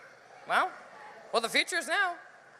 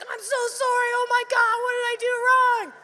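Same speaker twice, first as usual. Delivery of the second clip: flustered apology; 10 semitones higher, 4 dB louder than usual. Retold or imitated. Flustered apology to flight attendant.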